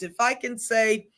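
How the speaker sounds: usual speaking voice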